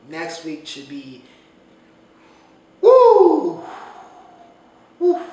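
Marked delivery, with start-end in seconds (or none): 2.8-5.2 high, loud, drawn-out "woo"; big high-pitched woo; whooping. Real, not acted. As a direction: let out two whoops, very high. High hype exclamation between segments.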